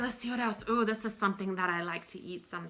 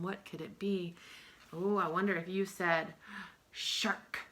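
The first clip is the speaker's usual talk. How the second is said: ordinary talk, nothing marked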